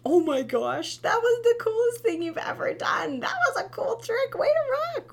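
very high, loud gushing praise; cheering parent. Retold or imitated. Mom celebrates toddler's fall, very high loud cheer. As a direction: cheer like the fall was a stunt. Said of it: "if we can check ourselves and instead".

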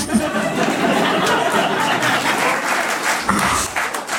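long wheezy unvoiced laugh; breathy silent laugh. Real, not acted. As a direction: laugh without voice, doubled over. Laughs at own joke.

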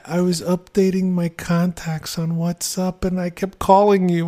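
high, gentle granny voice; man doing a woman's voice; soft old lady voice. Retold or imitated. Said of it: "A soft little old lady's voice".

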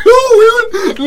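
high, loud laughing shriek of protest; laughing protest at a friend's remark; shocked laughing cry. Real, not acted.

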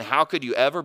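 ordinary delivery

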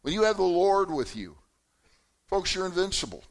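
loud, slow, drawn-out preaching with each phrase held; preaching at full force. Acted. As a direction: lift the room with fervour, slowly. Preacher opens with God's power.

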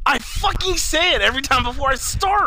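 high, loud exasperated yelling; angry shout. Real, not acted. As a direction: yell in real frustration. Scolding co-host over forgotten audio.

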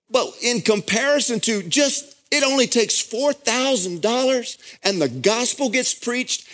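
high, ringing sermon voice at full force; fervent pulpit delivery; impassioned preaching. Acted. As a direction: preach it, swept up in the moment.

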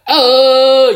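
sudden high, loud whoop; excited yell. Real, not acted. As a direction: shout it out like a catchphrase. Signature celebration after a pick.

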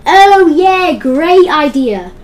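sarcastic. Retold or imitated. Mocking his own past decision.

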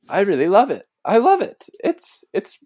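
high, loud gushing delight; gushing excitement. Retold or imitated. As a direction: gush like you're overwhelmed with joy. Retelling the girls' gushing reaction.

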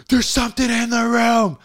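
high, loud frightened warning; scared urgent shout. Retold or imitated. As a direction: blurt it out in panic. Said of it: "I'm like".

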